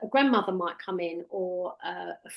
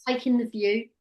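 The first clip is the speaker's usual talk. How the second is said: showing the trainee how to guide a client; hypnotic suggestion. Shown as a demonstration. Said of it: "When we say to someone".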